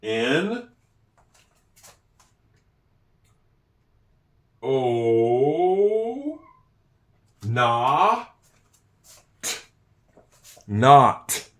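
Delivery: N, O, na, t, not, each sound separated and slow; teacher blending letter sounds into not. Shown as a demonstration. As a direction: spell it sound by sound, then blend. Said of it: "Do you hear the T at the end?".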